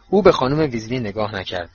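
ordinary delivery, nothing marked

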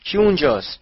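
loud, high-pitched call. A narrator's reading. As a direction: call out harder to an unseen stranger. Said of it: "دوباره صدا کرد". (با صدای بلند و زیر صدا زدن)

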